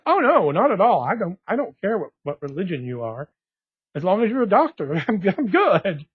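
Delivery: loud, quick-to-reassure "no problem" delivery; emphatic reassurance. Retold or imitated. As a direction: wave the worry away, ending on a laugh. Retold cheerful reassurance to the doctor.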